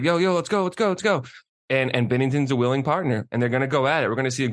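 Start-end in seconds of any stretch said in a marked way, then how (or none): 0.0-1.2 much higher, pumped-up yo let's go; hyped-up mock Fleury. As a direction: hype yourself up for a fight, imitating Fleury. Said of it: "he's like".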